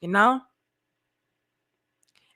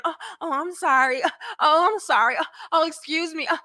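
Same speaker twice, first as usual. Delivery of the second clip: higher, coy fake apologies; mock innocent woman; 6 semitones higher than usual. Woman backing into a man at the gym, mock innocent. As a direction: imitate put-on innocence in little repeated oops.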